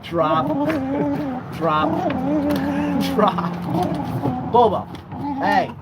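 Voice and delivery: loud, high, repeated commands; drop command. Real, not acted. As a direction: make him let go of the bone. Commanding dog to drop bone.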